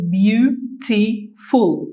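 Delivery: Beautiful drawn out slowly, each syllable clear; teacher modelling Beautiful syllable by syllable; slow modelled word. Shown as a demonstration. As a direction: stretch Beautiful so learners hear every syllable. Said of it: "That's a long word".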